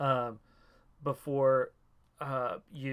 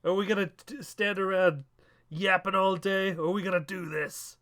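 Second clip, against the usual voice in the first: higher, louder theatrical goading; hammed-up raised-voice challenge; impatient promo challenge; 6 semitones higher, 4 dB louder than usual. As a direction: goad a rival into the fight. Acted promo challenge to rival.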